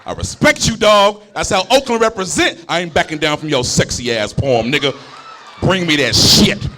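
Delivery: loud, pumped-up street challenge; hype shout. Acted. Poet squaring up to a rival, loud and pumped up. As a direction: puff up and call him out.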